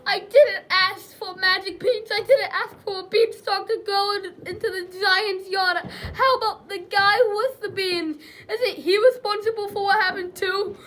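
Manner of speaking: loud, high, wailing self-defence; worked-up, high-pitched complaint, building to a demand; aggrieved protest. Acted. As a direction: protest the unfairness at full voice. Jack protesting blame in court.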